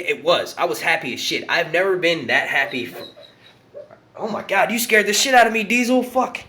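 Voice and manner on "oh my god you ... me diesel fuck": startled yelp at the dog; jumped out of skin, loud. Real, not acted.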